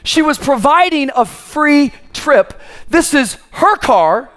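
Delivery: high, slow, drawn-out preacher's cry; full-force preaching. Acted. Preacher driving home whose car it was, full-force preaching. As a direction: hammer out each short line with a pause after it.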